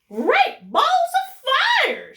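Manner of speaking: very high, loud, slow exclamation dropping at the end; a goose hollers in amazement; goose crying out. Acted. Goose sees the nest and hollers, amazed. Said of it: "she cried".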